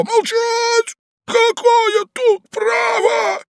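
high, loud, slow shout. A narrator's reading. Ivan Andreevich roars at the young man under the bed. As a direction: roar him into silence.

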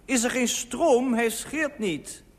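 high, slow, drawn-out call; calling downstairs. A narrator's reading. Husband reports the dead shaver downstairs, slow loud calling. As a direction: project each word down the stairs. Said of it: "riep ik naar beneden".

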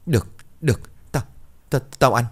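panicked agreement. Acted. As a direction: agree in a terrified rush.

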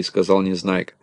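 ordinary delivery, nothing marked

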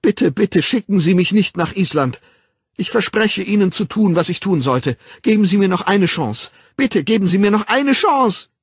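hoch und laut, verzweifelt bettelnd; flehend. A narrator's reading. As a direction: um Gnade betteln. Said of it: "Es flossen Tränen". (Bernhard fleht den Controller unter Tränen an)

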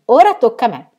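a quick happy claim, lifted up; eager call. Acted. Aunt eagerly claiming her turn at the rope.

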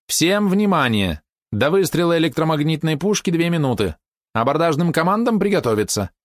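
объявление Виры. A narrator's reading. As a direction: announce it high to the whole ship. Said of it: "оповестил голос Виры". (высокий голос корабельного оповещения)

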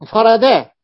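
انفردا punched loud and high; emphatic stress. Shown as a demonstration. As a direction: hit انفردا hard to drive the point home. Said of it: "انفرادها به".

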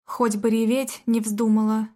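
ordinary delivery, nothing marked

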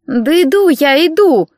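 slow, drawn-out grumble; sleepy younger sister grumbling back. A narrator's reading. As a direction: drag the words out like you just woke up. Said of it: "С ворчанием Женька".

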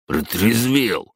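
slow falling grumble; grumbled. Acted. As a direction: grumble it sulkily. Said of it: "буркнул он". (буркнуть угрюмо)

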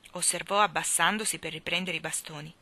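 ordinary delivery

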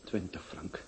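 breathless dying whisper; barely voiced, failing strength; faint sickbed whisper; weak whisper. Dying man whispers weakly.

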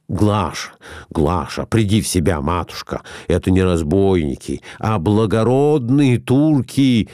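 ordinary delivery, nothing marked

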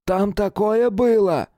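high, loud and slow with shock; agitated soldier. A narrator's reading. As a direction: blurt it out, still shaken. (взволнованный солдат)

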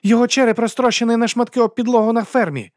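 high-pitched, cruel outburst; savage taunt. A narrator's reading. Woman taunts the duke with his son's death, savagely. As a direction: throw it at him, pitched right up. Вона добиває герцога звісткою.

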